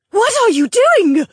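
high, loud alarmed shriek. Acted. Duchess Helmi shrieking at Evan as he draws the sword. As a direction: scream it as she lunges forward. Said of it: "Helmi shrieked".

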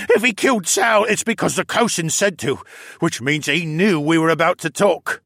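high, loud, panicky captive voice; frightened captive Luca. Acted. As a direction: voice a prisoner sure he will be killed.

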